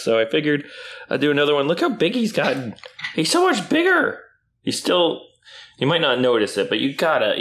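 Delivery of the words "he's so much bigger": pet voice. Real, not acted. High pet-talk about dog.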